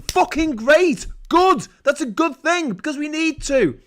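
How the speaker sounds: high, loud and emphatic; loud approval. Real, not acted. Praising Just Stop Oil to viewers, loud approval. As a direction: cheer it out fiercely.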